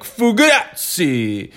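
high, drawn-out fake Italian cry. Imitating the boyfriend's running bit, fake Italian. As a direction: put on a hammy Italian accent. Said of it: "the fake Italian".

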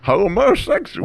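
drawn-out high mock echo. Friend echoes the word in a slow high mock voice. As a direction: stretch the word slowly, up high.

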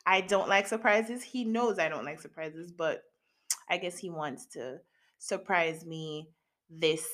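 ordinary talk, nothing marked

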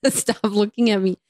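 ordinary talk, nothing marked

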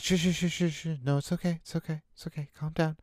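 hushed, high, coaxing soothe; calm them like a scared kid; soothing a child. Acted. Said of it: "And it's like".

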